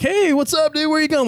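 high, bright party greeting; cheery buddy. Retold or imitated. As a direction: call out like a happy buddy at a party. Friend greets him downstairs.

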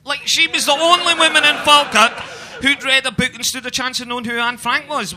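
loud, high heckle from the back; a heckler at the back of the audience yelling at the crowd; shouting heckler. Retold or imitated. Loud mock heckler call. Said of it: "stood up, pointed to a woman at the front".

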